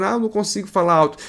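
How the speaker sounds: quick mock whine from a listener; mock complaint. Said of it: "Tem gente que fala".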